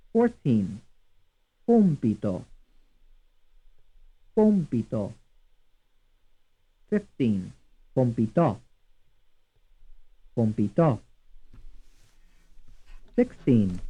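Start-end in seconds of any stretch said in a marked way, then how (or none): 1.7-5.1 "Compito" as COM-pi-to; stress on first syllable. Shown as a demonstration. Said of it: "stressed on the first syllable". 7.9-11.0 "Compitò" as com-pi-TO; stress on third syllable. Shown as a demonstration. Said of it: "stressed on the third syllable".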